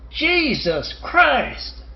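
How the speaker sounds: drawn-out, high-pitched mock scream; retold scream. Retelling a scream heard on a walk, high drawn-out mock yell. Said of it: "I heard somebody scream".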